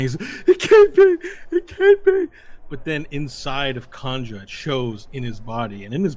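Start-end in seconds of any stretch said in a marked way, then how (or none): 0.5-2.3 very high, loud wail; squealing sobbed denial; crying. Acted. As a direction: bawl in hammed-up despair. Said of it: "you see him crying".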